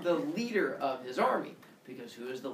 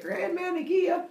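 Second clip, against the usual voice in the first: high, drawn-out, louder call; pleading call; 6 dB louder than usual. Acted. Zeus goes to his grandmother, high whiny call.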